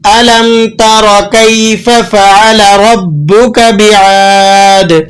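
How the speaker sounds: slow melodic tajweed recitation; Quran recitation. Shown as a demonstration. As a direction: recite the verse with measured melody. Recites verse.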